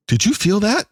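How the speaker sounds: high, surprised mock alien; a jokey mock puzzled alien; did you feel that. Alien crew surprised by the bomb hitting, high mock puzzlement. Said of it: "and they go".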